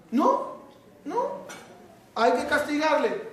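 sharp high "No, no" then firm "castigarle"; stern dad refusing, voice jumping up; father insisting the boy be punished; strict father. Retold or imitated.

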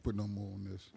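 usual speaking voice

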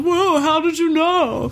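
slow, high-pitched astonished imitation; mock amazement. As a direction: draw it out in playful wonder. Retold amazed reply to mind-reading.

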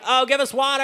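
high-pitched whining plea; hammed-up griping; mock complaining. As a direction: whine it like a fed-up crowd. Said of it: "so they're complaining now".

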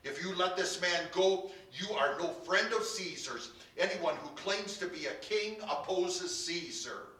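loud, high, sustained crowd protest; shouting. A narrator's reading. Crowd threatens Pilate over loyalty to Caesar, shouting. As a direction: shout a warning at the governor.

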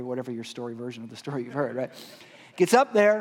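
usual speaking voice

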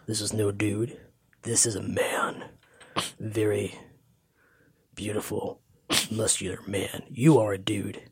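low, hushed, lingering admiration; awed Drax impression. Drax correcting them about the man they rescued, hushed awe. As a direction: drop deep and soft, with long pauses between words.

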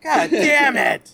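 slow laughing curse. Real, not acted. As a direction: laugh through the words. Laughing outburst after quote.

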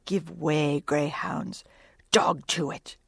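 low, hushed urgent whisper; soft, intense hissed urging. A narrator's reading. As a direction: whisper fiercely to the crew. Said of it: "in his old intense whisper".

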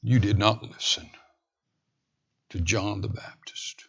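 hushed whispered aside. Acted. Preacher reminding the congregation about John, in a whisper. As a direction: drop to a whisper for the name.

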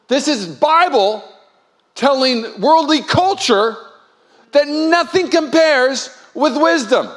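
slow, high, loud proclamation; preacher hammering the point, slow and loud; full-force preaching. Acted. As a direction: drive each word home.

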